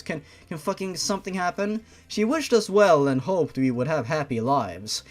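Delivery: ordinary delivery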